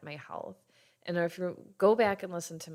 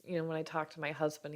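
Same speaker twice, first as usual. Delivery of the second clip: normal talking voice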